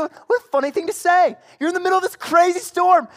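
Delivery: high, loud disbelief; loud incredulity. Acted. Sailors to Jonah in the crazy storm, loudly incredulous. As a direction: cry out at the absurdity of it.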